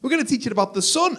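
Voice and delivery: put-on high-pitched excited child voice; mock kiddie announcement, pitched high; eager little kid. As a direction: pipe up like a keen small child. Children answering the robot.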